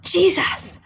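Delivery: gushing eager fan voice; eager young man meets Jesus; excited young man. Acted. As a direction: rush it out eagerly. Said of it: "he's like".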